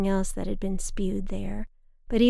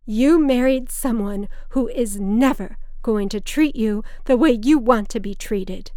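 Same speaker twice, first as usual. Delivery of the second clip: insistent; 3 semitones higher, 7 dB louder than usual. A narrator's reading. Drunk mother lecturing her daughter.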